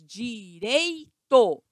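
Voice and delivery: "Direito" very slowly as di-rei-to; syllable by syllable. Shown as a demonstration. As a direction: stretch "Direito", keeping the ei together. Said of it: "separe as sílabas".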